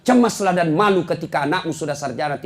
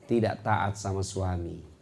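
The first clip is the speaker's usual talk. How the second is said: low, soft, slow warning; solemn warning; 7 semitones lower, 7 dB quieter, slower than usual. Real, not acted. Preacher warning wives, low and slow. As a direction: drop low and slow to warn.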